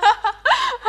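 high burst of loud laughter. Acted.

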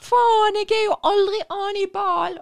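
loud, high yell across the field; shouting on the pitch. Retold or imitated. Footballer calling for the ball, loud high shout. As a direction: bellow it at a teammate on the pitch.

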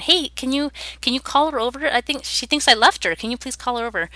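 high, worried asking for help; urgent plea. Retold or imitated. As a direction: press the friend quickly, anxious. Urgent plea to friend in car.

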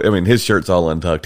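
ordinary delivery

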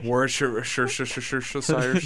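Worcestershire stretched out very slowly into repeated shushing syllables; drawn-out tongue-tangle on Worcestershire; comically garbled. Pretend. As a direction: trip playfully through "Worcestershire", piling up sh-sounds. Garbles the hard sauce name comically.

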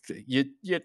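high, stammering nervous barkeep; a jittery host checking he's safe. Acted. GM voices nervous tavern owner greeting the party, high and stammering. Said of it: "He says nervously".

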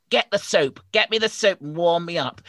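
loud, high, gruff demanding snowman; grumpy snowman. Acted. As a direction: bark like a bossy snowman.

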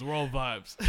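ordinary delivery

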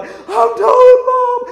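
high, loud wail, slow; crying shout. Retold or imitated. Little sister to brother after go-kart crash, crying shout. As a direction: yell through tears, drawn out. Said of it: "She's just crying".

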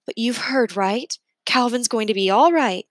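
a hint of a kind teacher easing his worry; gently hopeful; reassuring teacher. A narrator's reading.

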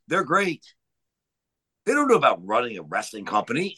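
ordinary talk

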